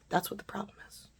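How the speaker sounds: hushed, whispered comeback; mock-angry answer under the breath; retold angry retort. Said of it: "I'm angry, right?".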